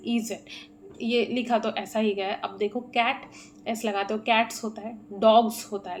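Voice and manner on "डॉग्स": डॉग्स louder, ending in a buzzed Z; Z sound at end. Shown as a demonstration. Teacher showing learners the plural sound, डॉग्स said with final Z. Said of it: "last में आप Z का sound आ रहा है".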